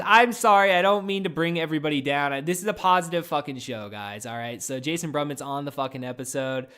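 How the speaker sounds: normal talking voice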